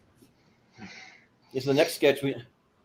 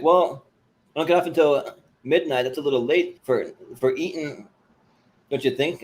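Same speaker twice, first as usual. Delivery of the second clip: Southern waitress; 3 semitones higher, 3 dB louder than usual. Retold or imitated. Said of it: "totally Southern redneck".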